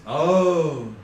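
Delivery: loud, swooping put-on "oh" in character; odd Keanu Reeves accent. Streamer playing Keanu as God, odd put-on accent. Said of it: "I don't know why that's his accent".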